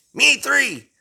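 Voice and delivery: high-pitched character voice chiming in; Mister Krabs. Acted. Mister Krabs agrees with the others, piping up high. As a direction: pipe up high. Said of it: "Mister Krabs says".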